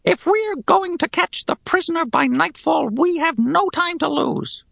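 high, grating goblin voice. Acted. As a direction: sneer it like a goblin soldier. Goblin leader orders band.

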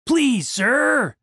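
anxious pleading. A narrator's reading. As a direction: beg the guard for mercy. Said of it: "pleaded Randy".

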